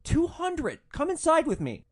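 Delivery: high, loud mock begging; loud, high-pitched insistent coaxing; incredulous pleading. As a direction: imitate a drunk man who can't believe he's refused. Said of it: "cannot believe that I won't do it".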